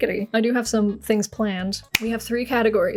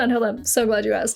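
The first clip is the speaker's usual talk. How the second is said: ordinary talk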